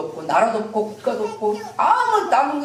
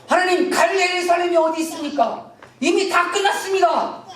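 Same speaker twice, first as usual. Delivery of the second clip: anguished protest; 6 semitones higher than usual. Acted. Jeremiah's despairing cry to God.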